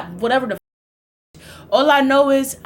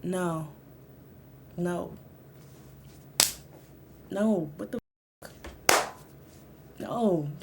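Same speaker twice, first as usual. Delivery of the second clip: put-on drunk mumbled denial; 6 semitones lower, 14 dB quieter, much slower than usual.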